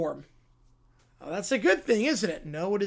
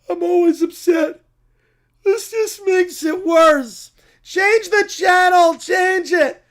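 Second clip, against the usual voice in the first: higher, loud, slow whiny yell; bratty shouting; 5 semitones higher, 6 dB louder, slower than usual. Acted. Brat yelling at someone to change the channel, loud whine. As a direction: yell it like a kid in a meltdown.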